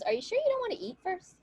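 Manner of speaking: high, quick pleading question; tentative. Acted. Woman stalling her angry betrothed, high and tentative.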